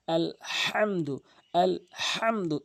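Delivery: slow আলহামদু with the হা from mid-throat; correct heavy ha. Shown as a demonstration. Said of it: "বড় হা হলকের মাঝখান থেকে উচ্চারণ করতে হয়".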